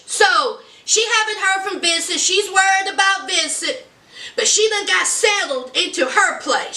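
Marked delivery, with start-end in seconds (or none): none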